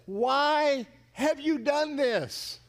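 very slow, high, drawn-out demand; appalled outcry, word by word; accusing cry. Acted. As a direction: stretch every word in loud reproach. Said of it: "and ask him".

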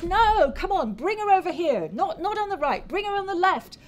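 high-pitched, urgent calling; urgent tiger snake. Acted. Tiger snake directs Hyena during the fight, urgent high voice. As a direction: call out directions in a high character voice.

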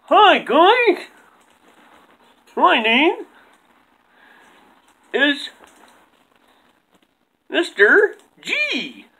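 high slow puppet coach; drawn-out puppet coach voice. Acted. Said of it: "I invited, uh, J T's coach on the show".

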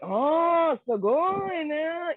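high, slow, drawn-out awe; impressed fan reaction. Retold or imitated. Japanese person hearing she wrestles, high drawn-out awe. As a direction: gasp it like you're genuinely impressed. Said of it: "that's the reaction you get in Japan".

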